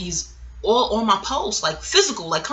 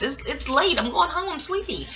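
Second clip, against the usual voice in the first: protesting excuse; 8 semitones higher than usual. Retold or imitated. Retold turning down his invitation.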